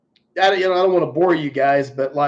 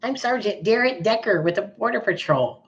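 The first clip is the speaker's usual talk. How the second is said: higher, softer mock officer voice; mocking the sergeant; 4 semitones higher, 5 dB quieter than usual. Mimicking the sergeant's introduction to sneer at him, lightly voiced. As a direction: parody his stiff introduction, lightly voiced. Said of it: "Oh, he was pissed".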